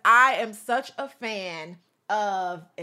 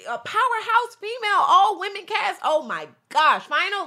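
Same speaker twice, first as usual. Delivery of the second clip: excited; 8 semitones higher, 5 dB louder than usual. Real, not acted. Excited about an all-women final.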